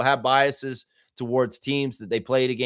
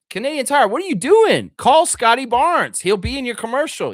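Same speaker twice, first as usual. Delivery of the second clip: higher and louder, a hammed-up plea; calling out; 12 semitones higher, 6 dB louder than usual. Real, not acted. Calling on Canadian Tire to sign Scotty Barnes, loud and high. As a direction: call out to the brand like you're pitching.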